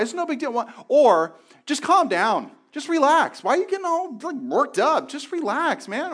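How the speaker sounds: high, loud mocking "just relax"; mock a friend telling you to chill; imitating someone brushing off anxiety; dismissive advice. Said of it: "we oversimplify".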